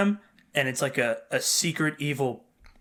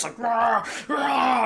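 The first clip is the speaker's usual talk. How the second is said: playful dog noise, pitched up; mock dog growl; 8 semitones higher, much slower than usual. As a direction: bark it out like a hyped dog. Imitating dog's growl.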